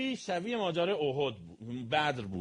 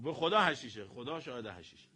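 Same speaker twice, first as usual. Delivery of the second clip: ordinary talk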